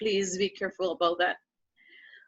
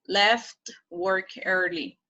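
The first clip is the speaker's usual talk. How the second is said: slow model reading; slower than usual. Shown as a demonstration. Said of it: "slow model reading".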